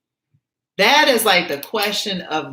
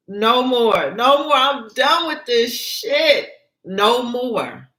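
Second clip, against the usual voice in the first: fed-up outburst; 5 semitones higher, slower than usual. Acted. Gina fed up.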